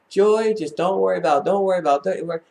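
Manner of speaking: acting out an impatient reassurance; retold brushing-off. Brushing off mother's complaints, retold.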